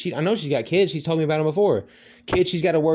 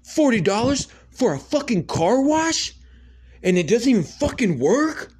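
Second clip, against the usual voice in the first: angry customer rant; 9 semitones higher than usual. Retold or imitated. Angry man yelling at the gas station clerk, high outraged shout. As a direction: rage like a customer ripped off by a broken car wash. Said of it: "he's like".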